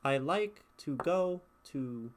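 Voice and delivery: slow beat, stressing "like" and "go"; iambic stress. Shown as a demonstration. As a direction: scan it with weight on "like" and "go". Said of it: "if I were to speak".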